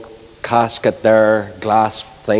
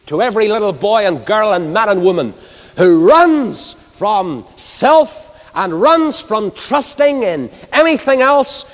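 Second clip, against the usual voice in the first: raised, ringing gospel preaching; fervent preaching; 8 semitones higher than usual. Acted. Preacher urging the nation to hide in Christ, fervent preaching. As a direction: preach it out to the whole hall.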